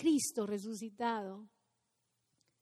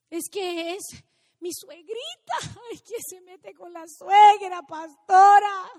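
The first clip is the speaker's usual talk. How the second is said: feligrés quejoso; 7 semitones higher, 13 dB louder than usual. Retold or imitated.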